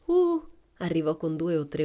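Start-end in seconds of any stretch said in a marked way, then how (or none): 0.1-0.5 high, loud "uh!"; shouted whoop. A narrator's reading. Mariella arriving with the men, shouting out a whoop. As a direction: let out a high-pitched cry. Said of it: "Mariella, gridando".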